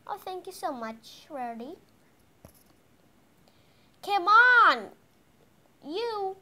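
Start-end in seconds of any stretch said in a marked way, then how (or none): 4.0-6.4 loud, high urging shout; exasperated call. Pretend. Mom to sleeping kids in the car, exasperated call.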